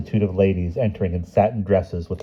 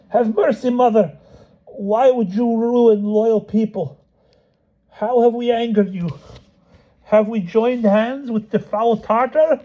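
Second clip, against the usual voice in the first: pleading Cossack. Acted. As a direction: beg with wounded dignity.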